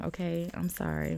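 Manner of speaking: ordinary talk, nothing marked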